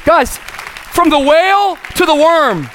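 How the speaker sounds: high, rhythmic preacher's shout building in force; full-force preaching. Acted. Preacher urging congregation back into God's will, full-force preaching. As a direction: drive it home in repeated, climbing phrases.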